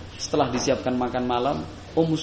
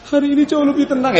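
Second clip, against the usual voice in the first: high, teary mock sob, louder; hammed-up weeping, pitched up; mock crying; 12 semitones higher, 8 dB louder than usual. Shown as a demonstration. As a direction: fake a tearful wail of the news. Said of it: "Bukan sambil nangis".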